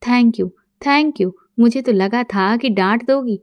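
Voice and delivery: ordinary delivery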